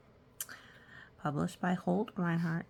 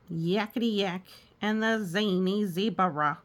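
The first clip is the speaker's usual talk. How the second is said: ordinary delivery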